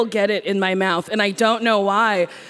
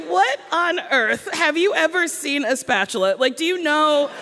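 exasperated disbelief; 6 semitones higher than usual. Retold or imitated. Mocking hopeless contestants on show.

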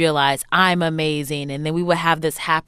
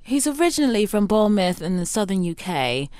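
badly faked British accent, pitched up; terrible British accent; 4 semitones higher than usual. Shown as a demonstration. Guest describes her British boyfriend's moves, in terrible British accent. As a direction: put on a hammy, unconvincing British accent. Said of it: "I do my terrible British accent".

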